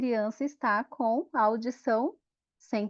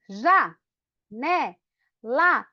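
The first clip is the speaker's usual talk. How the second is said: "Já, né, lá" split by clear pauses; syllable by syllable; much slower than usual. Shown as a demonstration. Teacher clapping out janela for the class, syllable by syllable. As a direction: clap out "Já, né, lá" one beat at a time. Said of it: "Com o dedinho".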